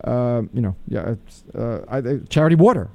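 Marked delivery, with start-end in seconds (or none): 2.3-2.9 WATER lifted above charity; stress on water. Shown as a demonstration. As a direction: put the emphasis on water. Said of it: "I would say".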